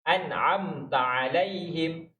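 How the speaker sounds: An'amta alaihim recited very slowly, nun and mim lingered on; slow recitation. Shown as a demonstration. Teacher reciting An'amta alaihim very slowly to show the mim. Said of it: "Mim pun sama".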